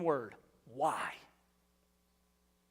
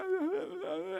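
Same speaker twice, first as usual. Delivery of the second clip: mock sob; 13 semitones higher than usual. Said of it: "and they're crying".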